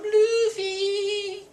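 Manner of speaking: high, drawn-out adoring squeal; hammed-up high-pitched pining; mock lovestruck swoon. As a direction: imitate a smitten character daydreaming of a sweetheart. Acted lovestruck thoughts of Hancock.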